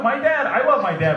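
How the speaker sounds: ordinary talk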